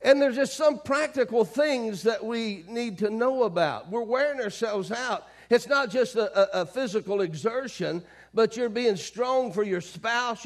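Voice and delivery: forceful preaching. Real, not acted. Preacher urging worn-out congregation, forceful preaching. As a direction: preach it hard, driving the list home.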